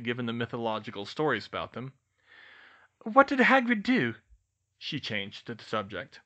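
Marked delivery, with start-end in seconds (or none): 3.0-4.2 put-on high, loud young witch's question; Hermione's voice. A narrator's reading. Hermione asks the twins about Hagrid, high-pitched Hermione voice. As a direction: pitch it up brightly as Hermione, pressing on. Hermione changes the subject in dialogue.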